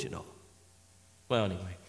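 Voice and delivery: ordinary talk, nothing marked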